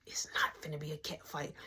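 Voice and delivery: low unvoiced whisper, shutting it down; hushed whisper. Shushing Mark about a cat fight, low whisper. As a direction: keep it secret, barely breathing it. Hushing friend about visitor.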